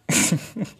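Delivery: a sudden, high, loud laugh; burst of high laughter. Real, not acted. Telling viewers about a hard pregnancy season, a loud high laugh.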